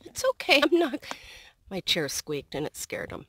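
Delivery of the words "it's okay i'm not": soothing the ducks. Real, not acted.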